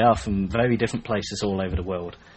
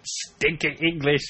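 put-on slow, higher French-accented taunt; comic French sneer, drawn out; mock French accent; 4 semitones higher, slower than usual. Trading silly insults with co-host, mock French accent.

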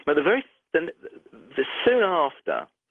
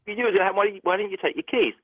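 higher-pitched imitated scolding; mock exasperation, pitched up; exasperated reproach; 6 semitones higher, faster than usual. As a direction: act out a colleague's baffled telling-off. Receptionist's exasperated reproach, retold.

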